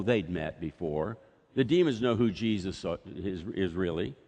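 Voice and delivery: normal talking voice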